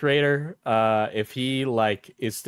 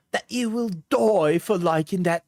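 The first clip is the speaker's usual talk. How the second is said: high-pitched mock Cockney threat; attempted Cockney; 6 semitones higher than usual. Streamer reading out an angry message, mock Cockney. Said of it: "It's Cockney, I think".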